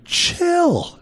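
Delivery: a sudden high screech at a noisy house; scream. Retold or imitated. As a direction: scream it in exasperation. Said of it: "you just wanna scream".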